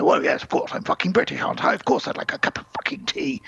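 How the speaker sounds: breathy, rising mock-British outrage; indignant Brit. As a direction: scoff as the most British man alive. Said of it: "the most British man you'll ever meet".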